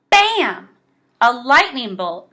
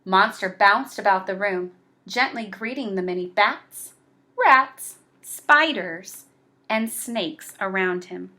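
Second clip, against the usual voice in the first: ordinary delivery, nothing marked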